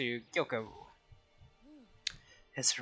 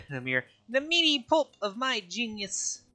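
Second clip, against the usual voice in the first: pompous character voice; 8 semitones higher than usual. Acted.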